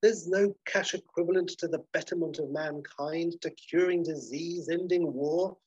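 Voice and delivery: high-pitched, theatrical lecturing; condescending hotelier. Acted. Basil lecturing Polly about money, high pompous condescension. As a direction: lecture her with grandiose condescension. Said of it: "Basil's like".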